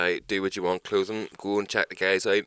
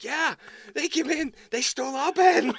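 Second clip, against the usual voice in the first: very high, slow squeaky voice; mock takeaway worker; 6 dB louder than usual. Takeaway worker complains to the fake cop, very high. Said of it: "It's like".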